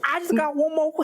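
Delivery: high, botched British attempt; clumsy mock-British; bad British accent. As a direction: have a go at British. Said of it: "my really terrible British accent".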